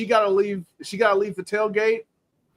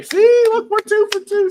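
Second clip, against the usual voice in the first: much higher and louder, delighted; excited; 8 dB louder than usual. Real, not acted. Host delighted his guest agrees, excited high exclamation. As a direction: crow that you agree again.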